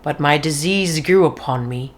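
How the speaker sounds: ordinary delivery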